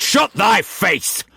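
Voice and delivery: put-on captain snarling at the young man. A narrator's reading. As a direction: bite the words off with contempt. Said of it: "Brock snarled".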